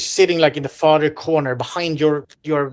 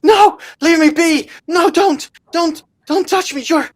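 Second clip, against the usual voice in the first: high, loud terrified pleading; a frightened eleven-year-old backing off; terrified girl; 13 semitones higher, 7 dB louder than usual. Acted. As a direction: shriek at them to keep away, like a scared child. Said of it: "she looks genuinely terrified".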